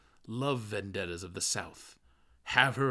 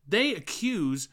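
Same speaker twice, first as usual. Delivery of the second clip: slow, raised and pointed; audible remark; 10 semitones higher, 7 dB louder, slower than usual. A narrator's reading. The man speaks up so the others overhear, slow and raised. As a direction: say it slowly and aloud so the room hears. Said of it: "remarked he audibly".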